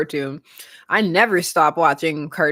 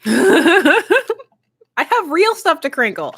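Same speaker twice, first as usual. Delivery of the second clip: laughing; 9 semitones higher, 3 dB louder, much slower than usual. Real, not acted. Laughing during ASMR joke.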